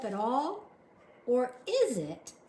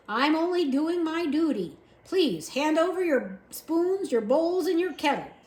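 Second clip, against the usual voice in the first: higher, louder stiff soldier voice; dutiful soldier; 7 semitones higher, 7 dB louder than usual. A narrator's reading. Soldier demanding the spoons from Uncle, high and loud. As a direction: read him as a rule-bound soldier.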